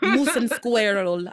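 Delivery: slow, hammed-up Russian accent; badly faked Russian accent. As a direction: imitate a cartoon Russian spy, drawn out. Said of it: "I can't do a good, like, Russian accent".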